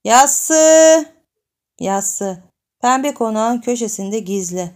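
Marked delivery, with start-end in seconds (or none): 0.0-1.0 loud, high call across the street; girl calling out excitedly. Acted. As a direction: shout the name to get his attention. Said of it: "heyecanlanarak sesleniyor".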